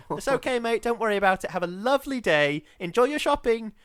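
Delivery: over-sweet sing-song brush-off; aggressively kind. Retold or imitated. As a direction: kill him with mock kindness. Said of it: "aggressively kind".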